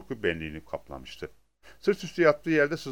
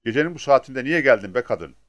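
raised, louder irritated question; annoyed grumble; 4 semitones higher, 8 dB louder than usual. A narrator's reading. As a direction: grumble at an unwelcome night visitor. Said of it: "diye homurdandı". (sinirli, yüksek sesle söylenme)

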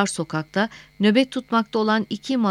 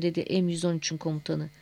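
ordinary delivery, nothing marked

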